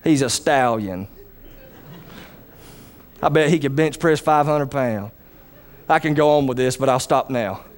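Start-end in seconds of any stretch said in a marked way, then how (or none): 0.0-5.1 lower, softer, self-admiring murmur; a preacher hamming up his own good looks; mock-conceited voice, lower and soft; mock vain admirer. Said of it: "I say".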